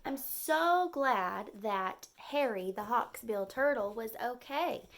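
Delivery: ordinary delivery, nothing marked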